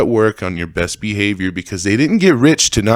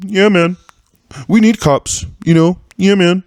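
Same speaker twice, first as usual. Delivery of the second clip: high-pitched, mocking rich-guy agreement; mocking rich people; 8 semitones higher, 3 dB louder than usual. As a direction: parody rich comedians chiming in, much higher. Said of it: "all these fucking rich people who go".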